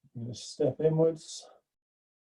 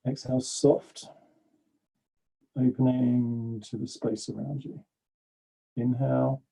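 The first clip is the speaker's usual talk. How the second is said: guided breathing. Acted. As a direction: guide the breath gently and evenly. Leads a breathing meditation.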